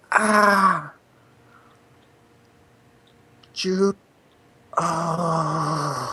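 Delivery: high anguished cry; pained cry. Acted. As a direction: cry out in distress. Character cries out.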